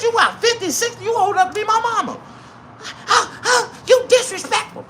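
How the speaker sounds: hammed-up high-pitched rant; 1 mock exasperated yell. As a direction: yell it half laughing. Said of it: "I like".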